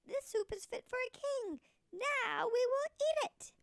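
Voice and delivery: put-on high, eager young man.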